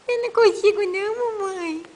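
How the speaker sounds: put-on baby voice.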